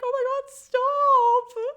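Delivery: very high, loud, slow wail of distress; a reader begging the sad scene to stop; anguished reader. Retold or imitated. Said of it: "you're reading this and you're like".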